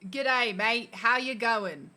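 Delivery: attempted Aussie greeting, rising on mate; learner's Aussie accent; Australian accent. Shown as a demonstration. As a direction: have a go at Australian. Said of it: "have a go in your Australian".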